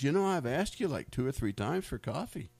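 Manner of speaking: annoyed complaint. Retold or imitated. Wife retold, annoyed at waiter.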